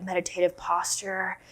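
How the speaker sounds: ordinary delivery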